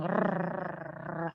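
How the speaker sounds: trilled Indonesian "rrrr"; long rolled r. Shown as a demonstration. Teacher showing an r foreigners cannot make, trilled r. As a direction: roll the tongue on a long r. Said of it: "sebut huruf R".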